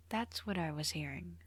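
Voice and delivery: hushed private realization; muttering to himself. A narrator's reading. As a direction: say it softly, as if to yourself. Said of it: "he muttered to himself".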